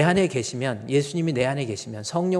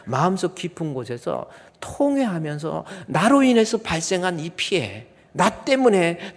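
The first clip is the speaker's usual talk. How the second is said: heartfelt repentance; 4 semitones higher, 6 dB louder than usual. Shown as a demonstration. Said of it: "아니라니까요".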